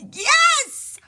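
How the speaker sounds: a high delighted cry; excited yes. Real, not acted. Streamer cheering a chatter's nickname idea, high and delighted. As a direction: yelp yes with sudden glee. Reacting to chat suggestion.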